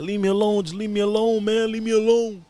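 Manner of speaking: hammed-up near-sobbing begging; crying plea. Retold victim begging the bully in the yard, imitated crying plea. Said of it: "it sounded like he was crying".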